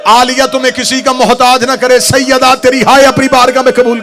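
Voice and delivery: impassioned prayer for the mourners; loud supplication. Acted. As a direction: call out the blessing over the gathering.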